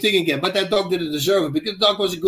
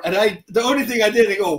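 usual speaking voice

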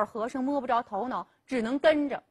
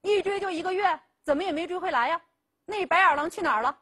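a touch of grim anger, higher and quick; grim questioning; 6 semitones higher, faster than usual. Acted. Father grills daughter about the runaway, grim and quick. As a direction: press her with sour, rushed questions. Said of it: "黄老汉就阴着脸问".

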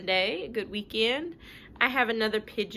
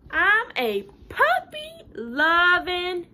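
proud pigeon; 7 semitones higher, 7 dB louder, slower than usual. Acted. Pigeon voiced.

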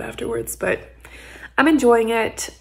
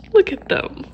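ordinary talk

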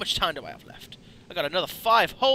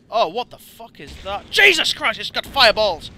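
raised, startled exclamation; loud panicked outburst; alarmed; 4 semitones higher, 9 dB louder than usual. Real, not acted. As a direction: yell in shock at a new enemy. Sees fireball enemy, startled.

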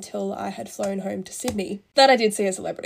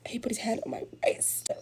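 hushed whisper, savouring the moment; 7 dB quieter than usual. A narrator's reading. Vlogger confiding to viewers about the celebrity's touch, in a whisper. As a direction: whisper it like a secret. Telling a giddy detail.

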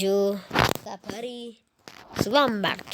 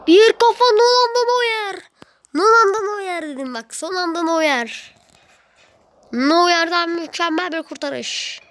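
mock commentary; 8 semitones higher, 4 dB louder than usual. Calling the goalkeeper's save, he plays the excited commentator.

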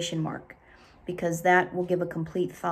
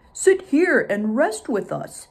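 slow, louder, kindly bear; 3 semitones higher, 6 dB louder, slower than usual. Acted. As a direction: bear invites the tired bee. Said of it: "said Bear to Bee".

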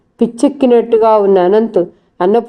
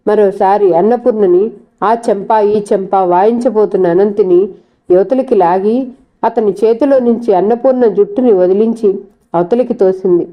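ordinary delivery

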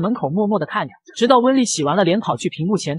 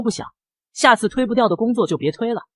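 usual speaking voice

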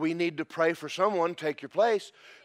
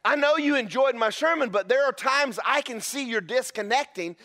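ordinary talk, nothing marked

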